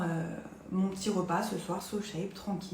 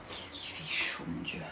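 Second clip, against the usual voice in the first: whisper; 8 dB quieter than usual. Real, not acted. Food too hot, aside.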